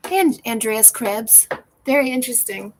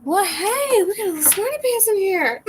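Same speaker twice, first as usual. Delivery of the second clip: high, playful tease, much higher; teasing; 11 semitones higher, 4 dB louder than usual. Real, not acted. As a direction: poke fun at the smarty pants. Teasing a German viewer.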